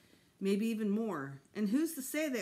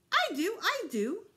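high, loud eager yelp; excited yelp; 13 semitones higher, 7 dB louder than usual. A narrator's reading. Fudge yelping when Dad offers pudding, excited. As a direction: jump at the dessert. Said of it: "Fudge yelped".

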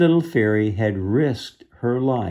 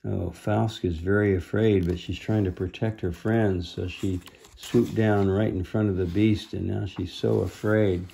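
ordinary delivery, nothing marked

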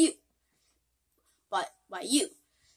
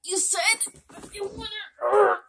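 high, louder sobbing protest; crying in pain; 11 semitones higher, 5 dB louder than usual. Acted. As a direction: wail it through the pain.

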